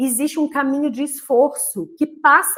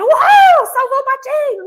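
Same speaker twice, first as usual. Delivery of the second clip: much higher, louder whoop of joy; euphoric cheer; 12 semitones higher, 11 dB louder than usual. Retold or imitated. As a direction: cheer like an overjoyed little girl. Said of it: "A flor que tava lá eufórica".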